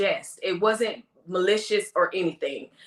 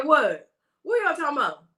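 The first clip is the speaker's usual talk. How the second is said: imitating his Florida accent, a bit higher; mock Florida accent; 5 semitones higher than usual. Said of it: "get my Florida accent".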